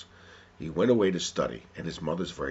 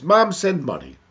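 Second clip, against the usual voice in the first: loud, higher-pitched demand read aloud; brusque demand; 11 semitones higher, 13 dB louder, slower than usual. Acted. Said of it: "It's how we say things".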